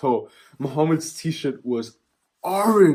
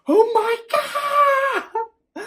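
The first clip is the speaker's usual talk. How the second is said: high, very slow mock scream; loud high drawn-out mock wail; imitated scream; 3 dB louder, much slower than usual. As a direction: wail it like a terrified friend. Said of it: "Junior was screaming".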